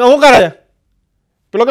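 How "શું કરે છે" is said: louder frog demand; angry frog. Retold or imitated. Frog to the boyfriend, louder angry demand. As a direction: confront the stone thrower.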